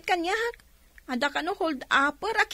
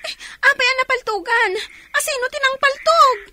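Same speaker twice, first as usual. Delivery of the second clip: shocked alarm; 5 semitones higher, 3 dB louder than usual. Acted. Shocked at news of shooting.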